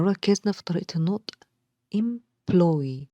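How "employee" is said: "employee" split, with a weighted PLOY; em-PLOY-ee, the O sound carrying the stress. Shown as a demonstration. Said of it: "هنلاقي إن ال O sound عليه stress".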